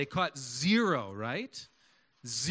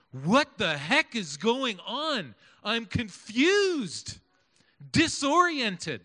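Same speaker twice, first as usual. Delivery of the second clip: confused disciples; 4 semitones higher, 5 dB louder, slower than usual. Acted. Preacher voices confused disciples.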